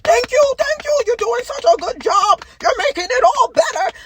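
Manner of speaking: high, loud sing-song mock praise. Mimicking society's fake thanks, high and loud mockery. Mocking elites' empty praise.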